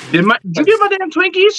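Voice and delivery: acting out desperate concern for snacks; alarmed demand. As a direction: voice shoots up, demanding an answer. Acted alarm over missing Twinkies.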